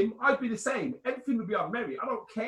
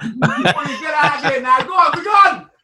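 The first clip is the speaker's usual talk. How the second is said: high, loud barking boss voice; shouting boss; 8 semitones higher, 14 dB louder than usual. Pretend. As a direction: bellow them out of the office. Mock boss throwing staff out.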